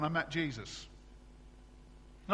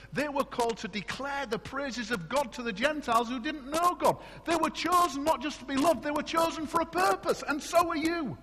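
raised, higher preaching voice with punch; full-force preaching; 9 semitones higher, 5 dB louder than usual. Acted. Preacher rousing his church about lost direction, full-force preaching. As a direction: drive the point home to the congregation.

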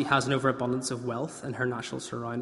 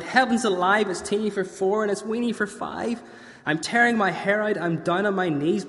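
put-on distraught old lady; 6 semitones higher than usual. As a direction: cry out like a harried granny.

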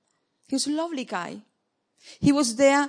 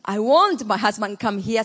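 ordinary talk

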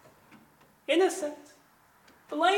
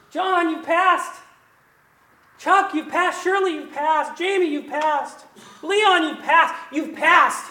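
loud, higher, ringing declaration; joyful proclamation; 7 semitones higher, 8 dB louder than usual. Acted. God telling each believer they passed, loud and joyful. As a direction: announce each name like glad news.

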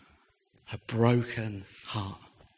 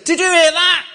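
much higher, loud imitated announcement; really loud voice; 12 dB louder than usual. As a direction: broadcast it to the whole carriage. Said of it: "he said in a really loud voice".

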